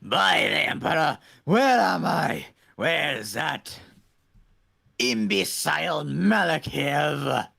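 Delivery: lightly voiced zealot preacher; Redeemer voice. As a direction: bellow like a fanatic warlord. Plays the Redeemer character.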